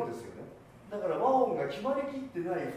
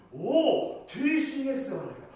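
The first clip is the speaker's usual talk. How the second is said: "おティーシーエスではないか" higher and louder; wondering exclamation; 8 semitones higher, 7 dB louder than usual. Shown as a demonstration. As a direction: let the "お" carry the wonder. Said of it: "そうです、そういうことです".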